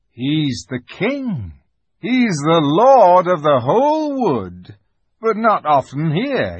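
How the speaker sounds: grand, drawn-out storyteller's conviction; Mister Beaver. A narrator's reading. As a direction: savour each phrase with reverent pride.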